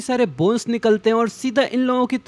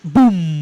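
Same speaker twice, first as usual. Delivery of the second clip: loud, low drawn-out boom; dramatic vocal boom; booming impact sound; 4 semitones lower, 5 dB louder, much slower than usual. A narrator's reading. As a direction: imitate an explosion with the voice. Narrator voices an impact sound.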